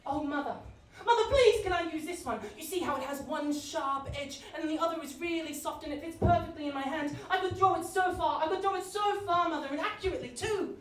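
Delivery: put-on high, eager pleading child voice; young biblical child. Child begging mother for the perfect rock, high and eager. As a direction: beg mother like an excited boy. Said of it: "more of an impression".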